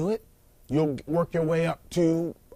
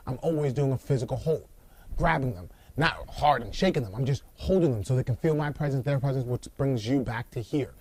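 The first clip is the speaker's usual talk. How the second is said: ordinary delivery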